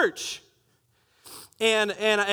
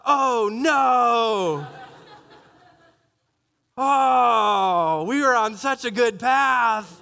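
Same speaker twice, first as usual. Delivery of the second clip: mock dismay; much slower than usual.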